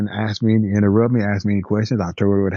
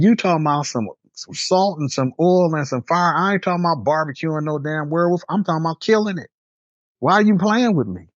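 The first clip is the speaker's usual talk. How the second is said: retold worked-up protest; 8 semitones higher than usual. Employee who thinks boss is joking about werewolves, acted exasperation. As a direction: act out being fed up at being teased. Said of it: "Calm down and just let me explain".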